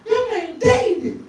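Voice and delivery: put-on high, slow girl's voice. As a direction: coo the name like an impressed girl. Girl repeats the name.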